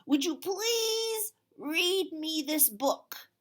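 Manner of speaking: slow, high, loud pleading; Duncan asking sweetly. Acted. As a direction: stretch out the please. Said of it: "he said to the raccoon".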